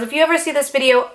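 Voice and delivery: ordinary delivery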